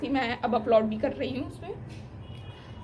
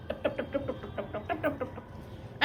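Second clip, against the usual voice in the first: mock teardrops; 8 dB quieter than usual. Shown as a demonstration. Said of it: "मेरे आंसू ऐसे ही है".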